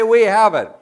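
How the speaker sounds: loud preacher call, We have it; rally the congregation to shout it back; call and response. Real, not acted. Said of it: "Everybody say".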